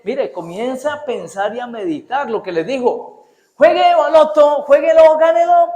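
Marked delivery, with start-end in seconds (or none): 3.5-5.7 high, loud hammed-up announcer hype; mock ad voice selling the lottery; lottery ad pitch. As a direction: shout it like a pushy lottery commercial. Mocking a lottery ad.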